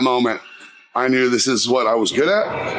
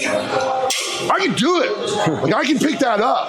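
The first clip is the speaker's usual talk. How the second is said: put-on much higher, laughing eager kid's voice; eager kid insisting; 9 semitones higher, much slower than usual. Twelve-year-old begs his mentor to let him lift, eager.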